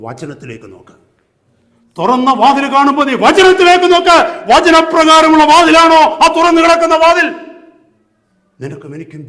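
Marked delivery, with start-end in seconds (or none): 2.0-4.2 loud, high preacher's shout; full-force preaching. Real, not acted. Same words repeated at full force. 4.5-7.3 loud, high preacher's shout; full-force preaching. Real, not acted.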